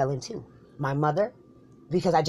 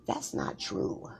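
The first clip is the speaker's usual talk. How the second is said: muttered denial; 14 semitones lower, 7 dB quieter, much slower than usual. Acted. Mock offended reply.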